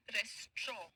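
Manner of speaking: "रेस्ट्रॉ" as res-traw, falling on "straw"; correct pronunciation. Shown as a demonstration. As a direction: model "रेस्ट्रॉ" in two syllables, no final t. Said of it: "इसको कहेंगे कैसे?". ("रेस्ट्रॉ" को दो हिस्सों में, बिना अंतिम ट के)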